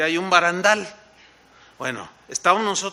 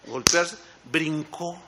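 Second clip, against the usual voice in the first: ordinary talk, nothing marked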